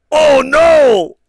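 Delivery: loud, high-pitched "oh no"; comic yell of dismay; mock horrified shout. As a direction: cry out in exaggerated dismay. Acted horrified reaction to the outfit.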